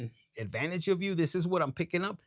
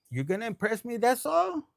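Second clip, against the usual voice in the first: mock incredulous challenge; 6 semitones higher than usual. Reader voices client unimpressed by flashed money, incredulous. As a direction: climb sharply into a scoffing question.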